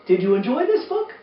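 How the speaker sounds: ordinary delivery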